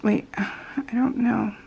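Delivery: slow unsure whisper. Real, not acted. Puzzling over the beads, whispered to herself. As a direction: whisper it to yourself, slowly.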